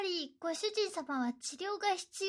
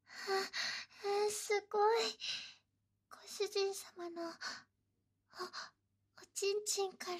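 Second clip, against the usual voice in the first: shy soft stammer; 6 dB quieter than usual. Acted. Shy maid stammering embarrassed.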